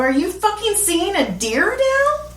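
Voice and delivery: high, slow, exaggerated disbelief; retold incredulity. Asking a tripping friend on the phone, hammed-up incredulous. Teller retells her disbelieving question.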